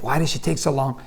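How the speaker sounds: unhappy grumbling. Retold or imitated. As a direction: complain to yourself under your mood. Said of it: "I'm not happy".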